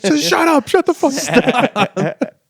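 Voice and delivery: very high, loud mock yell; producers' angry outburst. Producers silencing a bragging dating show contestant, hammed-up furious shriek. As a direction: shriek it to cut someone off.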